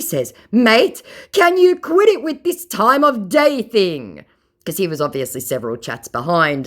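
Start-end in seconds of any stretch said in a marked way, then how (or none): 0.5-4.3 higher and louder, slow huffy whine; indignant mock scammer. Mocking the scammer's complaint, high whiny mock voice. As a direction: sound put-out and whiny.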